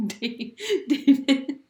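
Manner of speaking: high, slow, giggly stammer; words broken up by giggles; laughing. Real, not acted. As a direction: laugh through the name. Laughing at her own idea.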